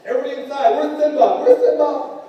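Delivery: high, loud calling out; mock alarm. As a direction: shout everyone inside in mock panic. Mock emergency on the playground.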